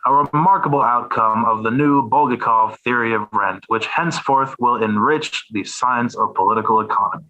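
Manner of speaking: measured formal reading of a quoted text; dry, deliberate recitation with a sarcastic edge; reading aloud. Acted. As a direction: read it like a scholarly polemic, deadpan.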